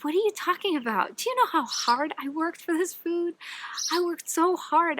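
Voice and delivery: defending his hard work to the owner; insistent. Acted. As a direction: argue it back hard and fast.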